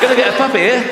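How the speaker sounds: giggling through a mock announcement; high, laughing sing-song; playful laughing chant. Comic mock chant while doing task.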